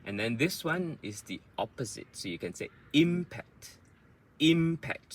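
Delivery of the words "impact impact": impact with IM high and stretched; long first syllable. Shown as a demonstration. Teacher shows the opposite rhythm, impact with long IM. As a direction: lengthen and punch IM in impact. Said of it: "the im is longer".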